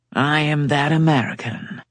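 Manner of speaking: drawled. A narrator's reading. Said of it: "Jake drawled".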